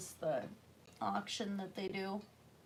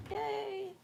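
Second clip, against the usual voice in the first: high little cheer; excited; 13 semitones higher than usual. Real, not acted. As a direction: squeal it out happily. Happy about time left.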